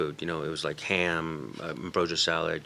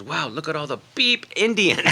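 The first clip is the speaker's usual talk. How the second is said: mock amazement; 8 semitones higher, 5 dB louder than usual. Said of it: "the guy looks out and goes".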